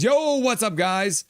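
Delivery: high, bouncy "yo"; hyped greeting. Retold or imitated. Reading a viewer's hello to the stream, hyped. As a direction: read the greeting with a high, lively lift. Reads chat greeting aloud excitedly.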